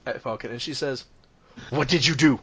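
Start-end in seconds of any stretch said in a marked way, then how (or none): 1.7-2.4 restrained first accusation, near usual pitch; accusing mother. Acted. Mother turning on her boy, restrained accusation. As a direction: ask it pointedly, holding back the yell.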